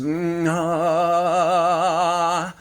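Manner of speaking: loud, high hum then open ah; sounding an emotion out as a coach's demo; releasing sound. Shown as a demonstration. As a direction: let a tone out loud from the chest. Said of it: "start to voice or to sound it".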